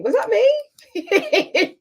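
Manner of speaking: very high and very slow, laughing in disbelief; amazed, laughing. Real, not acted. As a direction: stretch it out, delighted you said it. Amazed by her own quote.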